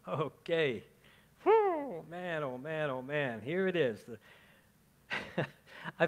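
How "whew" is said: high loud whistle-like whew. Acted. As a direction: blow out a high amazed whew. Reacting to Jonah vomited scene.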